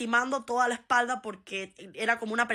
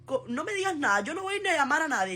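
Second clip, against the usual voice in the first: sulky dramatic friend; 6 semitones higher than usual. Retold or imitated. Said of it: "Él dice".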